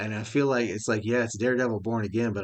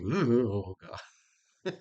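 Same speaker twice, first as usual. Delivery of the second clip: creeped out; much slower than usual. Retold or imitated. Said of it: "I was just like".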